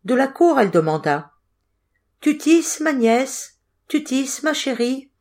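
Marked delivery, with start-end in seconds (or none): 2.2-5.1 high singsong questioning; higher-pitched wheedling witch aunt; Baba Yaga. A narrator's reading. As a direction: call out sweetly in a raised pitch, phrase by phrase. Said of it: "Baba Yaga s'impatientait".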